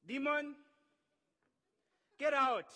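loud, high exorcist's order; commanding shout. Acted. Disciples confidently commanding a demon out.

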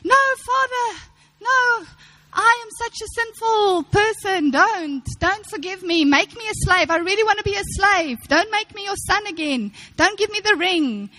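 high, hammed-up pleading sinner; mock grovelling son. Preacher mocking a prodigal son who refuses grace, high hammed-up pleading. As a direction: overplay the begging like a melodramatic penitent. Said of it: "you don't see the prodigal son saying".

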